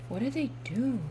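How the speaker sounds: soft, wondering question; hushed repeat. Real, not acted. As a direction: ask it softly, voice dropped in volume.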